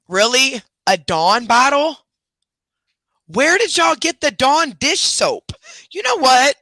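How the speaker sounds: high, loud, slow incredulous outburst; can't believe what the dogs did; dog owner finding the dish soap, high and incredulous; outraged disbelief. Real, not acted.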